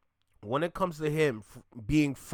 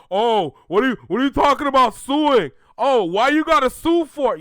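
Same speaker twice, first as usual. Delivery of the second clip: high whiny mocking fan voice, much louder; 9 semitones higher, 11 dB louder than usual. Streamer mocking the fans who defend the lawsuit, in a loud, high, whiny voice. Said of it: "here come the fans now".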